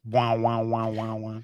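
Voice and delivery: mouthed wobbling bass drone; steady, pulsing vocal "wah" noise; wobble bass imitation. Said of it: "Hit the wobble bass".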